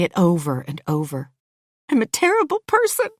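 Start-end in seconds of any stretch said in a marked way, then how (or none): 1.9-3.1 very high, rushed tearful wail; crying out. A narrator's reading. Louise confessing while being comforted, crying. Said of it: "I cry".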